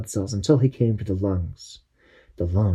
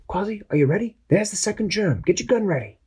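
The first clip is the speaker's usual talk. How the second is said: higher, lively captain giving orders; captain briefing his crew, voice pitched up; Captain Barnacle voice; 11 semitones higher, faster than usual. Acted. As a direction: call the plan like a brave little captain.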